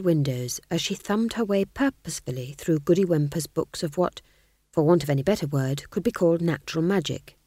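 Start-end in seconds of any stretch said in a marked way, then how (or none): none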